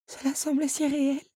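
high, wistful disbelief; dismayed heroine. A narrator's reading. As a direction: murmur it high, disillusioned. Belle, shaken by the illusion. (désillusion, voix haute)

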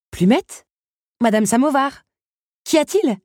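high, loud, anxious questions; worried. A narrator's reading. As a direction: call each one by name, rising with concern. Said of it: "s'inquiéta Belle". (inquiète, voix qui monte)

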